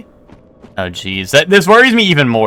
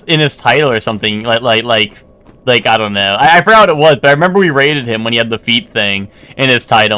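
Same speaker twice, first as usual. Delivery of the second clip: normal talking voice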